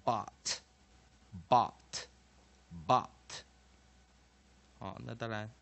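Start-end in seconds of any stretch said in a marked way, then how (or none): 0.0-3.1 "but" said "bot", open ah and crisp final T; spaced, loud teaching repeats of "but" as "bot"; short O drill. Shown as a demonstration. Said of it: "短母音O就念啊".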